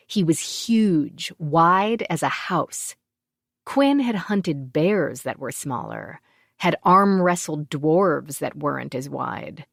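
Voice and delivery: plain reading voice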